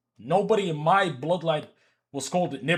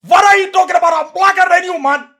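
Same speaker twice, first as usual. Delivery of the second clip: much higher, loud yelled protest; retold friend yelling back, high and loud; indignant shout; 17 dB louder than usual. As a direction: bellow it like you're insulted. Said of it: "And he's like".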